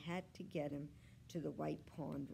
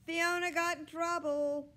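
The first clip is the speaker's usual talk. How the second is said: high, loud announcement; boy's urgent raised call; 11 semitones higher, 10 dB louder than usual. A narrator's reading. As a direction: holler the news across the house. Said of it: "he called".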